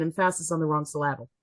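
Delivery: "Emphasis" as em-FASS-is, "syllable" as suh-LAB-ul; stress on wrong syllable. Retold or imitated. Parent correcting kids' mispronunciation, misplaced stress in "Emphasis" and "syllable". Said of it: "my kids will mispronounce things".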